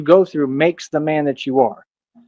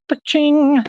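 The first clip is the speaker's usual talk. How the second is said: high sound-effect ping; 12 semitones higher than usual. Acted. Calling down instant karma.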